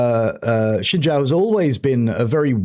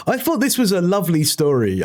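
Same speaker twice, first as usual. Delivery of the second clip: usual speaking voice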